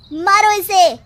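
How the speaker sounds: mob-rousing cry to strike; shout. Acted. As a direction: whip up the crowd to kill her. Villager demands killing the girl.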